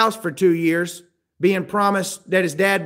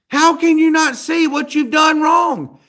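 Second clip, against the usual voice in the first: loud, high exasperated shout; 9 semitones higher, 4 dB louder than usual. Retold or imitated. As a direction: yell in disbelief at their denial. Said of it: "And you're like".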